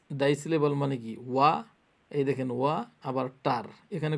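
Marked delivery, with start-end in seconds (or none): none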